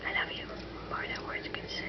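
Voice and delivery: whisper. Acted. Whispering to bird.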